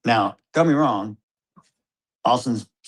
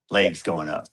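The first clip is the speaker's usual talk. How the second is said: ordinary talk, nothing marked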